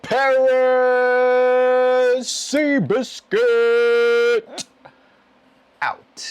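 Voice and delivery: loud, high announcer holler with endlessly stretched vowels; huge sustained shout, every name held long; drawn-out shouted sign-off. Pretend. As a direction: belt out each name like a sports announcer. Theatrical shouted sign-off after "with that.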